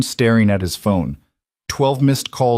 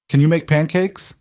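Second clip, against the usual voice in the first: put-on little girl voice; 8 semitones higher than usual. A narrator's reading. Child asks dad for pancakes.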